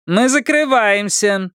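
high, drawn-out waitress call; slow, high-pitched closing-time call; loud announcement. A narrator's reading. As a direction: call it out across the café. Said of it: "громко сказала официантка".

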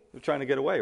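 usual speaking voice

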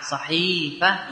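syllables stretched; slow demonstration. Shown as a demonstration. Teacher showing the base word to students, slowly. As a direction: say it slowly to show the kasra. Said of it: "صحيح بكسر الحاء".

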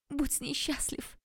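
high, slow put-on sob for drama; fake sob. A narrator's reading. Heroine hams up a sob as she leaves her ex. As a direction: wail it like a stage heartbreak. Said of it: "всхлипнула я".

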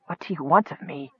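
a wounded knight gasping weakly. Acted. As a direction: force it out through the pain. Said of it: "the wounded man managed to gasp".

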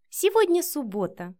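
smug little jibe; snide smirk. A narrator's reading. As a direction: point it out with a smirk. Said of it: "с ехидной усмешкой произнесла Вика".

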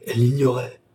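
plain reading voice

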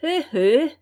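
high, knowing hé hé; smug chuckle. A narrator's reading. The servant teasing his master, smug chuckle. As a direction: chuckle like you know better. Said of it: "fit encore Lapierre".

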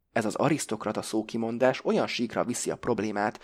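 plain reading voice

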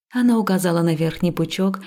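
ordinary delivery

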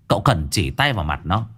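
usual speaking voice

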